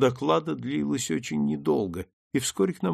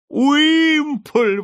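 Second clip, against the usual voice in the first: much higher, louder rapturous cry; ecstatic; 10 dB louder than usual. A narrator's reading. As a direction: swoon over the name. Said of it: "в каком-то экстазе". (в экстазе, гораздо выше и громче)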